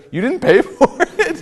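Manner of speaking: high-pitched question breaking into a laugh; slow, amused, incredulous, laughing through it; retold laughing disbelief. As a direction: imitate someone cracking up mid-question. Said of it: "starts laughing and he says".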